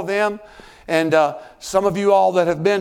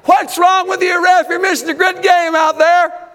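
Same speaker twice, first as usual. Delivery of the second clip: high, loud heckle at a referee; irate shouting; 9 semitones higher, 5 dB louder than usual. Acted. Sports parent at the referee, shouting. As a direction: yell it like an outraged fan in the stands. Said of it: "Because we're shouting".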